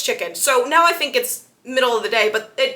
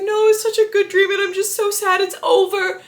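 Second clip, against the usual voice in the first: higher, lightly voiced dreamy whine; whiny Bella; 8 semitones higher, 3 dB louder than usual. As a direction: gush with mock sadness. Bella crying over good dream.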